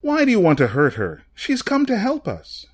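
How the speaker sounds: urgent appeal, climbing high; calling out. A narrator's reading. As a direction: call out to reason with an attacker. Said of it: "More rational voices called out".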